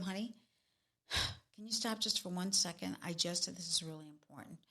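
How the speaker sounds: low, hushed, slowed-down appeal; sigh, then drop pitch and volume to ask gently. Shown as a demonstration. Coach modelling a wife answering a snappy husband, low and soft. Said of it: "You have to get lower".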